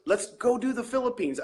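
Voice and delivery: high-pitched retold urging; lightly voiced pal making a pitch; imitating a buddy. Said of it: "a buddy of mine in the Philippines".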